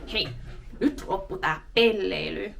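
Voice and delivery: a hammed-up mock telling-off; joking scold voice. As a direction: pretend to scold a goofy friend. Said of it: "in that kind of voice".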